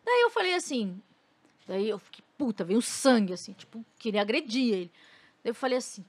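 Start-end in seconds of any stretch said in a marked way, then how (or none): none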